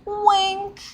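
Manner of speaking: high-pitched little "wink"; spoken wink. Acted. Repeating scientist's wink aloud.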